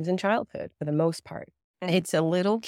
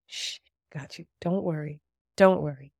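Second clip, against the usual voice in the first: hushed, unvoiced comforting shush; calm the scared child like a parent; soothing hush. Brain part soothing a child.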